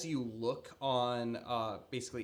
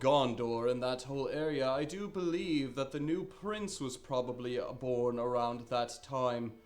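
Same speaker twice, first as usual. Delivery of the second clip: [name] in character; 3 semitones higher, 4 dB louder than usual. Acted. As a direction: ponder it aloud like a learned old man.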